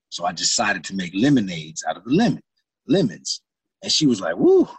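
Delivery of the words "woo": impressed woo. Retold or imitated. She reacts to his attitude.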